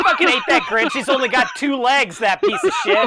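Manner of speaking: high-pitched comic rant at the Grinch; playful, over-the-top loathing; mock outrage. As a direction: rant indignantly through the laughs.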